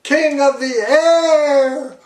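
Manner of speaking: high, loud exultant boast; gleeful boasting. A narrator's reading. King boasting from the sky, high and gleeful. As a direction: crow about everything below you. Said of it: "shouted Yertle".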